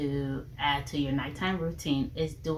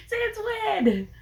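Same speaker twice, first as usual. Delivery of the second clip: playful incredulous cry, pitched way up; mock disbelief. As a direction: squeal it in pretend shock. Repeats mock shock while laughing.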